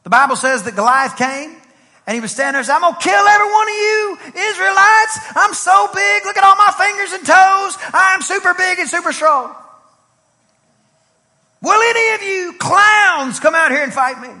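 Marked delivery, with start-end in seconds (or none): 2.8-14.3 high, loud taunting shout; screaming giant. Acted. Goliath challenges the Israelite army, high taunting shout. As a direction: bellow a boastful challenge at the army. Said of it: "Who is that screaming down there?".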